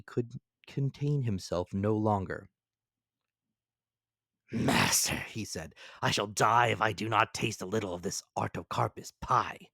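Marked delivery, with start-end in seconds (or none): 4.5-9.7 eager Ned Land. A narrator's reading.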